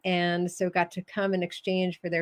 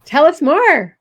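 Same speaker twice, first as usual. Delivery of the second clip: high, loud, keen "Tell us more"; eager prompt; 7 semitones higher, 11 dB louder than usual. Real, not acted. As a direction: push them eagerly to go on.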